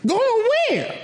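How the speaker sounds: very high "going where?"; stunned by the news; shocked. Retold or imitated. Housemates hearing he is moving out, very high shocked question.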